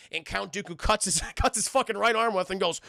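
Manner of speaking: ordinary delivery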